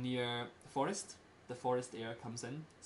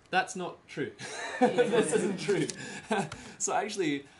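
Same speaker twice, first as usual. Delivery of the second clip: laughing correction; 7 semitones higher, 10 dB louder than usual. Real, not acted.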